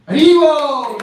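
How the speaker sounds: high, loud devotional shout; jubilant call. Real, not acted. Preacher hails the happy end of the story, jubilant shout. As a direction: call it out to the whole congregation.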